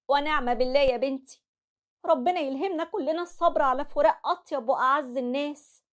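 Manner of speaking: calm her down like a loving mother; soothing mother. Acted. Mother comforting her broken daughter, tender.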